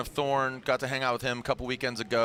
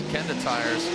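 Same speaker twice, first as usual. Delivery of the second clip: ordinary talk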